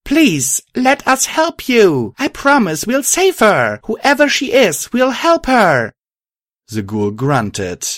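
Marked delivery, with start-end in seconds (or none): 0.0-5.9 high, loud, drawn-out plea; urgent high-pitched pleading; pleading shout. A narrator's reading. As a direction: beg the enemy at the top of your voice. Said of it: "I shouted at the dark creature".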